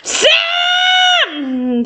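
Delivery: long, high holler of a name. A narrator's reading. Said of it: "Jason louder".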